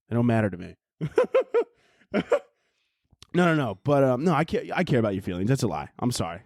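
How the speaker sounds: usual speaking voice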